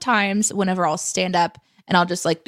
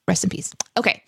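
ordinary talk, nothing marked

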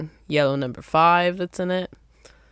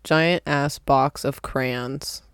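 slowly, word by word; slow careful retry; much slower than usual. Real, not acted. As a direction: spell each word out carefully. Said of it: "I can't even say it".

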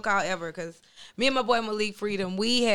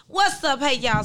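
normal talking voice

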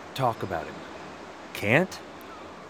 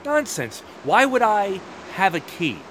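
indignant disbelief; 8 semitones higher than usual. Acted. Guard rejects accusation about key.

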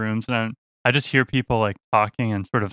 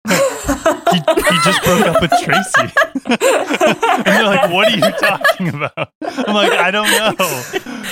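laughing; 10 semitones higher, 5 dB louder, slower than usual. Real, not acted. Laughing retelling the weird encounter.

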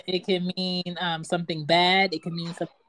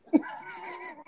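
shrill laughing squeal; high squeal laugh; 6 dB quieter than usual. Real, not acted.

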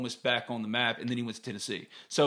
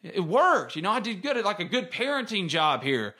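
proud dad; 6 semitones higher than usual. Retold or imitated. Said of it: "my dad's, like, smiling".

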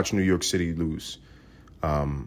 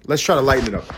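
ordinary talk

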